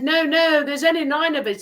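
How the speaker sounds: high, loud protest; insistent boy. Retold or imitated. Boy protesting to the stranger, high and loud. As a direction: insist hard that nobody miscounted.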